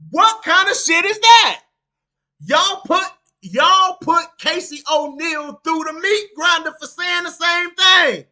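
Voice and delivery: outraged shout. Real, not acted. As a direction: blast the hypocrisy. Outraged at double standard.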